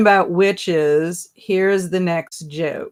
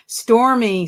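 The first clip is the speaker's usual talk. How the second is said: ordinary delivery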